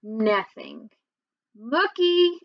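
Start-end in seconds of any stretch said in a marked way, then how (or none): 1.6-2.4 higher, firmer name call; lifted, slightly louder call; a little louder. A narrator's reading. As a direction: call the name to rouse a sleeper, pitched up. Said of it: "I said a little louder".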